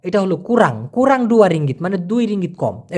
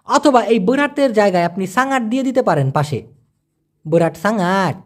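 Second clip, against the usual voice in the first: ordinary delivery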